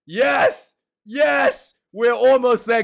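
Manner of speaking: high, loud, eager yeses; excited yes. Real, not acted. Vlogger cheering his viewers, high and eager. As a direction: bounce with excitement.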